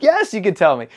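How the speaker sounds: egging her on with a loud, high yes; eager yes. Retold or imitated. Eager reply to Karen, retold.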